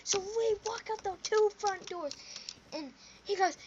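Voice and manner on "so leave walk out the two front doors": high, commanding bratty order; bossy kid. Retold or imitated. Kid ordering the drunk guy out, high bossy. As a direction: order him out like you own the place. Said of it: "I said".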